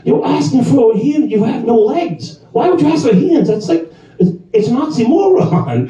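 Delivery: high, laughing mock lecture; smart-aleck explanation. Bartender schools the customer, high teasing laugh. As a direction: explain it like it's obvious, chuckling. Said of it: "I was like".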